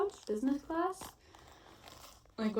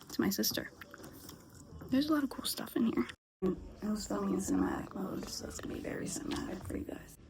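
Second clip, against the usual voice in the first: whispering; 5 dB quieter, faster than usual. Real, not acted. Whispering inside the estate sale.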